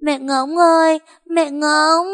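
put-on high, loud tearful child's call; crying little girl calling. A narrator's reading. Monkey calls after her leaving mother, high and tearful. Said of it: "mếu máo khóc gọi".

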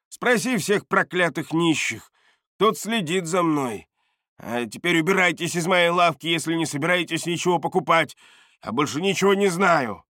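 high-pitched irritated outburst; agitated dismissal. A narrator's reading. Бардак orders them out of his shop, high irritation.

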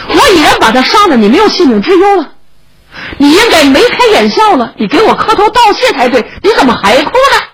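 high, quick urging; exasperated reassurance. Acted. Rescuer urges sobbing child to cheer up.

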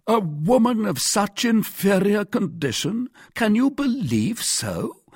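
high-pitched, incredulous disdain; contemptuous. A narrator's reading. Porthos doubts the mercer's wife, contemptuous. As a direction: question it with a scornful pout. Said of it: "protruding his lips with contempt".

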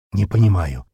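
plain reading voice

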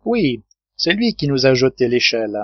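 plain reading voice

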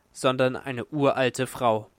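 ordinary delivery, nothing marked

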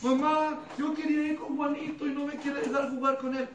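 put-on high, loud whiny child; a child whining to his mother; crying child. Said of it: "el niño, llore y llore". (niño llorón que se queja con su mamá)

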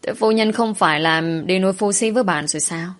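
ordinary delivery, nothing marked

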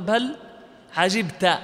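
"عجبت" recited as ʿajibta; fatha on the ta. Shown as a demonstration. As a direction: end "عجبت" on an open -ta. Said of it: "رواية قالون".

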